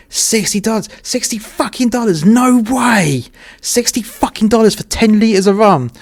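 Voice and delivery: high, loud disbelieving outburst of joy; can't believe the price, almost yelling with glee; shocked excitement. Retold or imitated. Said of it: "I was on cloud nine".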